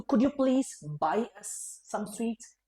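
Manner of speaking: very slow, word by word; slow teacher demo; very slow model request. Shown as a demonstration. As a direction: drill each word slowly. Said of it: "तो".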